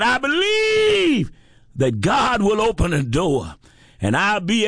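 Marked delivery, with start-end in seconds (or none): 0.0-1.3 high, slow, ringing believe; soaring affirmation. Acted. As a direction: lift it up in faith. Repeated affirmation, climbing.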